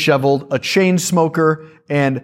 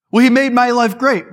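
mock eager testimony; 8 semitones higher than usual. Mock evangelist argues with skeptic.

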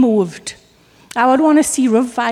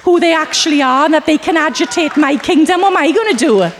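mock panicked devil; 4 semitones higher than usual. Preacher mocking the enemy, panicked and higher. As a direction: ham up the devil shaking in his boots. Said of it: "He is shaking in his boots".

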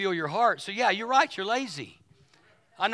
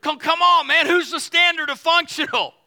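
loud, high-energy pulpit voice; raised, emphatic preacher's delivery; preaching; 7 semitones higher, 8 dB louder than usual. Real, not acted. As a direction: punch it out to the whole congregation. Preaches at full force.

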